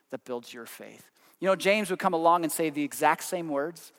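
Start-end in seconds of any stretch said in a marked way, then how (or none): 0.1-1.1 soft echo. Acted. Preacher echoing his point to the congregation, hushed and slower. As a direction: repeat the phrase quietly and a little slower. Preacher echoes the phrase softly.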